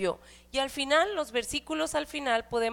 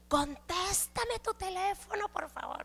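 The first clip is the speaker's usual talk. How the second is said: pleading, pitched up high, fading to nearly nothing; a worried mom's desperate plea into the phone; frantic mother; 7 semitones higher, 6 dB quieter than usual. Retold or imitated. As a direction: beg the absent child to pick up. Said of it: "Me pongo bien histérica".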